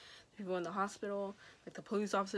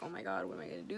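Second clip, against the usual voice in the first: scared friend; 12 semitones lower, 4 dB quieter, faster than usual. Retold or imitated. Friend voiced panicking over car.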